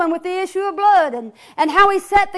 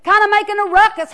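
ordinary delivery

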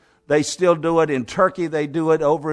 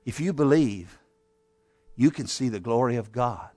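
gentle reassurance; 7 semitones lower, 6 dB quieter than usual. Acted. Jesus comforts the father.

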